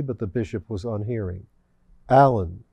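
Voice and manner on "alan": a firmer, louder call of the name; more firmly. A narrator's reading. Devil calling the unhearing bishop, firmer and louder. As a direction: call him louder, insisting. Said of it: "more firmly".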